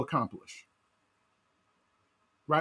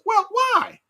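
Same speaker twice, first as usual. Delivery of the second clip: higher, louder "why" pressed home; challenging why; 13 semitones higher, 4 dB louder than usual. Acted. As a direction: press them with a sharp "why". Pointed challenge to listener.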